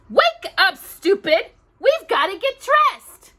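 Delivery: high, loud snapped insult; snapping. Acted. Drusilla snapping at distracted Cinderella, angry. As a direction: snap at her to wake up. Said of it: "Drusilla snapped".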